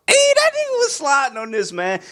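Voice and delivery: high-pitched burst of hype; excited hype. Real, not acted. Reviewer raves about the rapper to viewers, excited hype. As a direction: cry out in high-voiced admiration.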